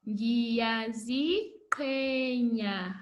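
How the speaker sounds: "Ngiyaziqhenya" drawn out very slowly, each syllable clear; slow model word. Shown as a demonstration. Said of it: "Let's say it together".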